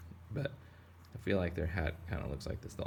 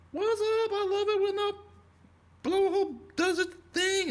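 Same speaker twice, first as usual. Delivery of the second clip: very high squeaky cartoon voice, louder; tiny islander voice; 4 dB louder than usual. Villagers on the whale's back cheering, squeaky high voice.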